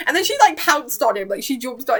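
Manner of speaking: normal talking voice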